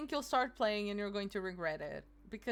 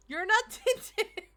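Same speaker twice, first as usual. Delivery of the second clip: laughing; 12 semitones higher, 5 dB louder, slower than usual. Real, not acted. Laughing through the line.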